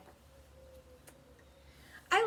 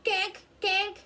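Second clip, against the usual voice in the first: high, louder duckling quacks; baby duck quack; 10 semitones higher, 8 dB louder than usual. A narrator's reading. Baby ducks at the pond, high loud quacks. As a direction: squeak it out like a tiny duck. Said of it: "They say".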